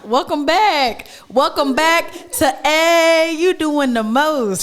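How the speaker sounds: slow, drawn-out host intro; show intro. Acted. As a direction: announce the show name slowly.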